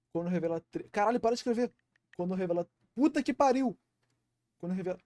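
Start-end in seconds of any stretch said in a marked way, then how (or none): 0.9-1.7 higher, louder annoyed outburst; frustrated swearing. Real, not acted. Text keeps advancing while reading. 2.9-3.8 loud, high exasperated curse; frustrated shout. Real, not acted. Loses the line again.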